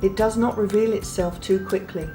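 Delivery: ordinary delivery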